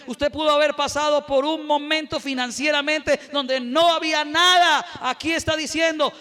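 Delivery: full-force preaching. Acted. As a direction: preach it hard to the congregation.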